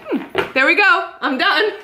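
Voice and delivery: high-pitched, playful triumph; joking elation. Retold or imitated. Shop owner joking after being praised, triumphant. Jokes her life's work is finished.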